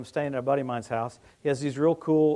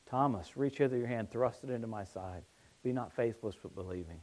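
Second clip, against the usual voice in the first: gentle Jesus; 5 semitones lower, 9 dB quieter than usual. Acted. Jesus voiced, soft.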